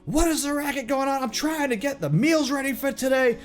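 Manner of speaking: high, screechy scolding; very angry innkeeper. Acted. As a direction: scold them in an irate, high-pitched woman's voice. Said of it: "throws it down on the ground very angrily".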